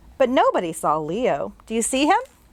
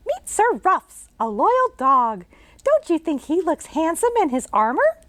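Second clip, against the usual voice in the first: put-on much higher girl's voice; bright little girl; 8 semitones higher than usual. Jane greeting the ghost she can see, as a bright girl. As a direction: chirp it like a curious girl.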